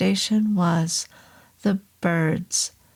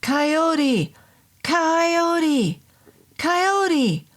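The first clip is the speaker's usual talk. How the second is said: long high calls through cupped hands; high ringing shout, rising each time; 9 semitones higher, much slower than usual. Acted. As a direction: call the name out into the fog. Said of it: "cupped my hands around my mouth and shouted out".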